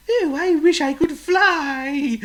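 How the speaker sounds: a tiny bird sighing for the sky; wistful little bird voice; little bird wishing. Acted. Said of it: "said Chirp to Oink".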